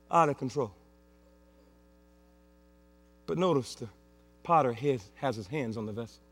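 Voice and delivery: soft, low reassuring preacher voice; tender reassurance. Acted. Pastor reassuring the congregation, softly tender. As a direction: comfort them gently.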